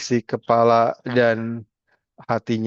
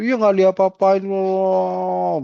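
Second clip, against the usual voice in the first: high, slow, drawn-out grievance; aggrieved complaint; 8 semitones higher, 3 dB louder, much slower than usual. Retold or imitated. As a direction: stretch out the complaint, still upset. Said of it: "marah-marah".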